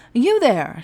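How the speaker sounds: sharp, loud, high command; snapping. Acted. A captain snapping at the guardsman. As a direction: bark it at him. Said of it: "she snapped at the guardsman".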